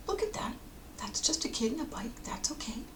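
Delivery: softly calm a nervous dog; hushed, tender talk to a pet; gentle soothing. Acted. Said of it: "while speaking gently".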